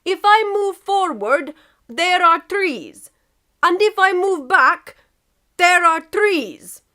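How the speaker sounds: put-on slow, loud heavy Russian accent; patient Russian explanation. Russia explaining the trees to England, slow Russian accent.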